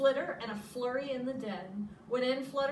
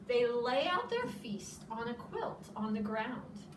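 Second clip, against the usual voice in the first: plain reading voice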